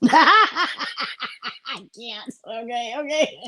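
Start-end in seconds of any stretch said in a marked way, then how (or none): 0.0-2.3 laughing. Real, not acted. Cracking up at the picture.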